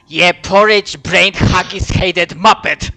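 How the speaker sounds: loud hammed-up Scottish dwarf; Scottish accent. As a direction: bellow Scottish insults. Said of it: "he would be responding like".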